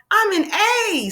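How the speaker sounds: high excited yell; vowel yelling its name. Acted. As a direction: shout the letter name proudly. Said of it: "it's gonna yell out".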